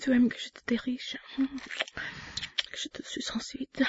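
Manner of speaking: soft intimate whisper. Acted. Slow whisper.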